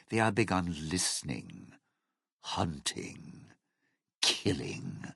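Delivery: low, hushed ominous list; ominous warning. Acted. Warning a partner about the ghost people, ominous.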